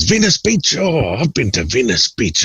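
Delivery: hammed-up surprise; mock excitement. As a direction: feign delight at the place name. [name] excited about Venice Beach.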